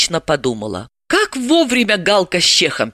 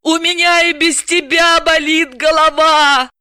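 wailing complaint; 12 semitones higher, 4 dB louder than usual. Acted. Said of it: "взвыла Далила".

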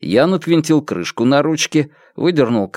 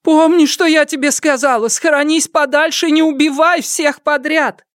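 put-on high, urgent old mother's call; calling after him. A narrator's reading. Mother calling warnings after fleeing son-in-law, high urgent call. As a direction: call out high, insisting that it be remembered.

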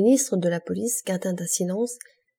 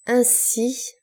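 higher, drawn-out opening word; pale anger; 5 semitones higher than usual. A narrator's reading. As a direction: open with contained royal anger. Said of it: "pâlissant de colère". (colère contenue)

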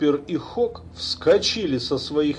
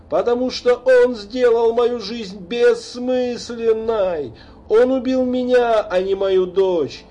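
put-on furious drunken raving; 8 semitones higher, 4 dB louder than usual. A narrator's reading. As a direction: rage out the vow. Drunk father vows revenge. (пьяная ярость, громко и высоко)

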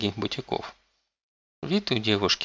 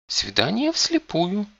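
whiny spoiled pouty drawl; 11 semitones higher, slower than usual. A narrator's reading. Spoiled girl character speaks.

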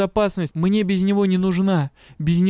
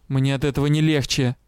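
plain reading voice

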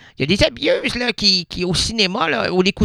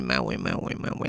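very low; 13 dB quieter, faster than usual. Acted. Mock drop.

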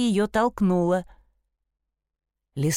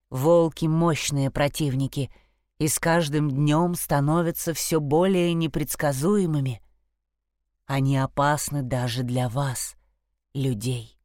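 lower, grave, solemn stag; deep stag voice; 5 semitones lower than usual. Acted. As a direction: speak as a wise old stag. The stag warns the girls.

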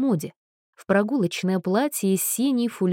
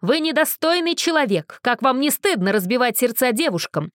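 high, loud outraged rebuke; angry scolding; 5 semitones higher, 3 dB louder than usual. Acted. Outraged mother shames Anna at the window. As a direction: shame her with fury.

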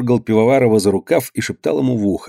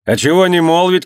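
higher, defiant raised voice; shouted retort; 7 semitones higher, 3 dB louder than usual. A narrator's reading. Usov defies Ivan's threat, shouting back. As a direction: shout back in defiance, not at full force. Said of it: "кричал Усов".

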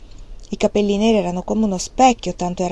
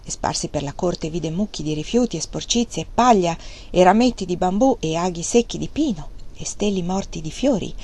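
ordinary delivery

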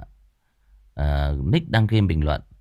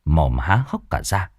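louder, lively horror-story telling; story narration; 5 dB louder than usual. A narrator's reading. Narrator telling the ghost story to listeners, lively and loud. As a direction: narrate the night scene with energy.

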